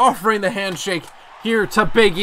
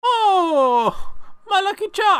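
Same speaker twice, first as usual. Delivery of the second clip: hammed-up Irish accent climbing much higher; badly faked Irish, drawn-out cries getting higher; attempted Irish accent; 5 semitones higher, much slower than usual. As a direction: do a cartoon leprechaun Irish accent. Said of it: "I hate doing the accents".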